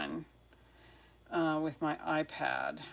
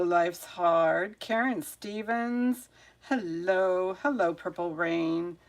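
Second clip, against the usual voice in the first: usual speaking voice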